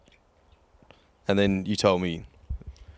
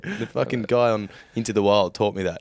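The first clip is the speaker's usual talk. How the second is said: usual speaking voice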